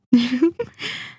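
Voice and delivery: brief rising villain's chuckle; gloating chuckle. Acted. Vampire gloating over a new thrall, brief amused chuckle. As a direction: chuckle with relish at your prize.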